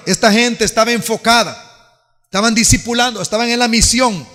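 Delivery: loud, rising sermon voice; fiery preaching. Acted. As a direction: preach it to the whole church. Preaching to congregation.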